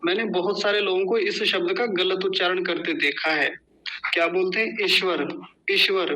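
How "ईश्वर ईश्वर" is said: ईश्वर as a quick ish-var; wrong short ई. Shown as a demonstration. Teacher imitating a common mistake, short-vowel mispronunciation. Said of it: "बड़ी की मात्रा को छोटी".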